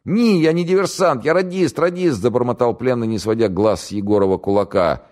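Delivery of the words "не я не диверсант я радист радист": much higher, louder panicky pleading; frightened prisoner. Acted. Captured radio operator to the interrogator, high panicky pleading. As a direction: babble it out in terror.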